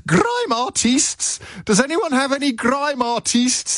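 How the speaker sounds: very high, drawn-out posh English call; posh shout. Acted. Older official calling to young guests in Downing Street, mock posh shouting. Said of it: "shouting in a very posh voice".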